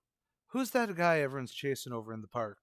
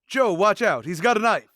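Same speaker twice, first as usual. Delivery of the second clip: loud, higher alarm call; urgent warning; 6 semitones higher, 10 dB louder than usual. Acted. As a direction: yell the warning across to Joe. Partner warns of knife.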